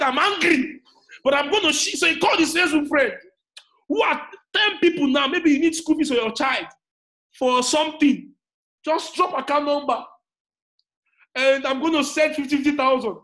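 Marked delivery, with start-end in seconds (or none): 0.0-2.0 higher, louder imitation of an angry boss; mock-angry announcement, raised voice; retold angry mentor. Mentor announcing his giveaway to friends, mock angry. 3.9-13.2 raised, louder voice of a retold giveaway; acting out mentor. Mentor offering money to Facebook friends, animated and raised.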